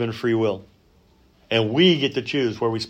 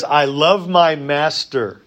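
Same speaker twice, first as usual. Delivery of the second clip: slow solemn vow; 3 semitones higher, 3 dB louder, slower than usual. Acted. Reads servant's vow slowly.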